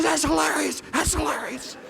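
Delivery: wheezing through laughter, mock driver; imitated hard laughing. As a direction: choke the words out as if cracking up. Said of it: "He's laughing so hard".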